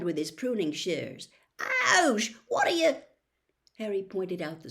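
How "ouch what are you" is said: sudden high, louder ouch, cut off; startled yelp. A narrator's reading. Ron struck on the hand by Harry, yelping high and loud. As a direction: yelp at the sharp pain. Hit over the hand with shears.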